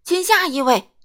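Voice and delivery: very loud, high holler; booming shout. A narrator's reading. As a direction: boom out over the customer. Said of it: "说起话来轰隆隆的". (轰隆隆地高声大吼)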